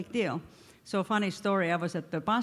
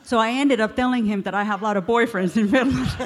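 real laughter breaking into the punchline, louder; laughing through it; 2 semitones higher, 7 dB louder than usual. Real, not acted. Woman telling the congregation her snow and boyfriend mix-up, laughing. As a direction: giggle out the confession.

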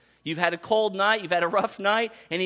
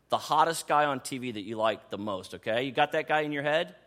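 ordinary talk

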